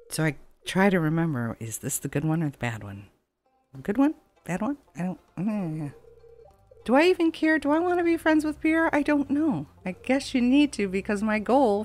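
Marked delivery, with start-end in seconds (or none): none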